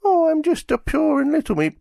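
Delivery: very high, sweet little creature squeak; mock-innocent squeaky creature; cutesy innocent Meep. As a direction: play the innocent pet, sugar-sweet and high. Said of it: "so I will paraphrase the Meep".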